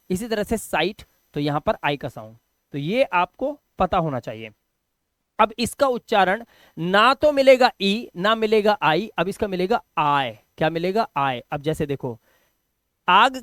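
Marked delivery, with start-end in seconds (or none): none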